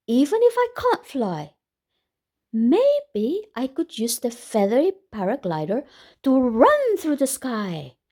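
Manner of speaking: lively, animated storybook reading with big rises; bright exaggerated read-aloud voice; reading to children. A narrator's reading. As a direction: read it out like to a room of kids. Reads picture book to children.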